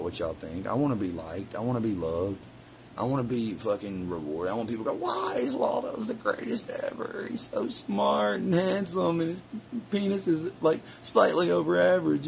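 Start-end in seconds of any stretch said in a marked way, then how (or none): 5.0-12.3 gushing fan. Pretend. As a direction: swoon over him like a fan. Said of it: "that's the devil talking".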